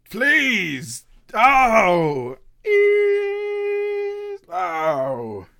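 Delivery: high, loud cries of pain easing off; shattering glasses' cries. Acted. Bottles shattering in the background, crying out in pain. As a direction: squeal like breaking glass.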